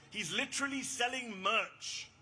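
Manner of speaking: ordinary talk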